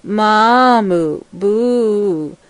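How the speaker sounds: slow, drawn-out bellowing moan; moaning bull grunt. A narrator's reading. The bull husband calling the herd, a slow fearful moan. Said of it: "moaned and grunted".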